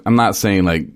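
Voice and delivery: ordinary delivery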